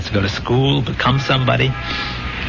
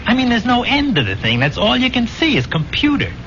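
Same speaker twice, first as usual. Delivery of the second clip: higher-pitched, agitated recounting; overawed; 10 semitones higher than usual. Acted. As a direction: tell it as if still overwhelmed by the sight. Recalls an endless, frightening computer.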